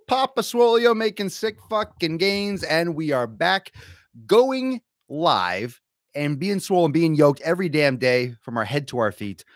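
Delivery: high, loud opening shout; pumped-up podcast intro; hype show announcer. Acted. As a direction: announce yourself like a champion, settling by the end.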